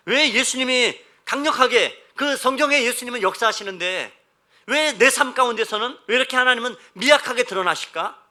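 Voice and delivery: full-force preaching. Acted. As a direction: challenge the congregation with force. (높고 힘찬 목소리로 다그치는 설교조)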